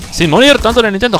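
high, loud relieved cry; excited shout. Real, not acted. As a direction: burst out with relief. Just survived a fight.

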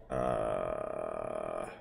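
long low, quiet croak; drawn-out creaky groan like a horror ghost; Grudge-like croak. Real, not acted. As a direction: let a low "uh" rattle out slowly. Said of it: "sounded like the fucking Grudge".

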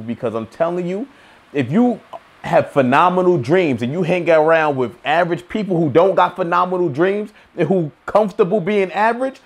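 ordinary talk, nothing marked

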